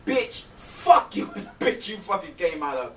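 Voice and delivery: acting out being mad as hell; cursing someone out in a retelling; retold mad outburst. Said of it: "Now I'm mad as hell".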